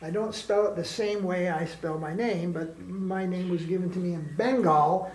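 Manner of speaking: ordinary delivery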